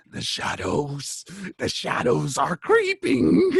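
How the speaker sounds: raspy voice rising to a high cry; hoarse whisper. Acted. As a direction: rasp it, then climb high and loud. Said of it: "in a ho-, hoarse whisper".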